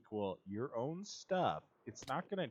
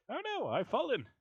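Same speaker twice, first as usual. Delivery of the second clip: startled yelp; 11 semitones higher, 3 dB louder than usual. Real, not acted. Falls in game, yelps.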